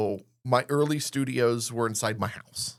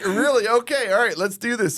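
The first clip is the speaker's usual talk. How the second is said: high, louder pep-talk to himself; mock psyched up; 8 semitones higher, 5 dB louder than usual. Host getting ready to pitch Jim, high psyched-up self talk. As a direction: gear up like before a big pitch.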